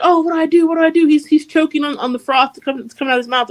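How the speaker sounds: high fretful mock panic; panicked. Swordsmith fretting over choking boy, high mock panic.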